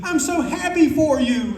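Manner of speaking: very high, stretched-out gushing; slow, squeaky-high mock joy; kid-like delight. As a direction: imitate a little kid overjoyed for a friend. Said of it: "they're just like little kids".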